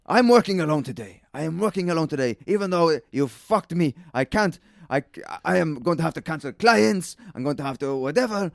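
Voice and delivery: high, exasperated mock scolding; imitating the boss losing it, pitched high; mimicking a furious boss on the phone; angry boss again. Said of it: "He goes".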